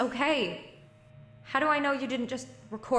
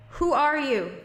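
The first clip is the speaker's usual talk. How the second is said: loud, higher, demanding question; raised voice demanding a name; 4 semitones higher, 6 dB louder, slower than usual. Acted. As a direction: confront the stranger head on. Confronting unseen voice on radio.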